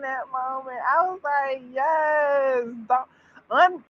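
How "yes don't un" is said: excited cheer. Retold or imitated. Acting out her delighted reaction.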